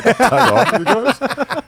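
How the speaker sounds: high, drawn-out asking voice; mock meek request. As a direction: ask to tag along, comically high. Acted slave asking to join.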